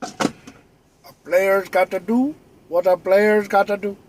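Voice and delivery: slow, put-on swagger voice; put-on player voice. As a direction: drawl it like a smooth player. Catchphrase in a put-on voice.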